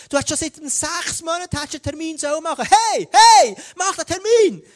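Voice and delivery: high shouted heys and rapid-fire reminders; loud nagging distraction. Acted. Intrusive thoughts interrupting someone sitting down with God, loud, high and nagging. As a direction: pester insistently at the top of your voice. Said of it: "ist das plötzlich laut".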